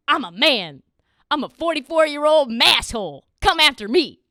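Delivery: host hams up Stevens as a tough Boston guy; mock macho boast. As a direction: puff up and dare them, loud. Parodies Stevens' macho tweet.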